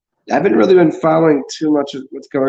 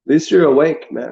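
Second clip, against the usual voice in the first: bright sarcastic praise, slightly raised; mock congratulation; 2 semitones higher than usual. Mocking the awakened as doom comes, sarcastic cheer.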